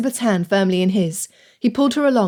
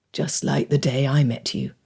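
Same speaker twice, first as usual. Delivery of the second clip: low, soft and tender; deep male voice; 7 semitones lower, 3 dB quieter than usual. A narrator's reading. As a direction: lower your voice for him. Fitzwilliam's voice in the story.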